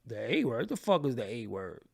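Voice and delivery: mock bafflement with sharp pitch jumps; baffled retort. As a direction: react as if you've never heard the term. Retold baffled reaction to doctor's term.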